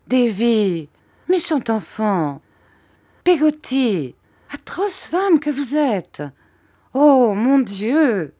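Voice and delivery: high petulant outburst, Oh mon Dieu slowed; capricious irritation. Acted. Mother turning from son to maid in petulant irritation. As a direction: lash out peevishly at both of them. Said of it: "avec une irritation capricieuse".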